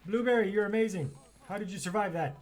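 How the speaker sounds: high, excited gushing; amazed praise. Real, not acted. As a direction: gush in amazement at a miraculous survival. Praising horse that survived.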